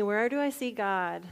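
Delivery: usual speaking voice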